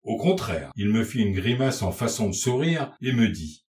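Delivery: ordinary delivery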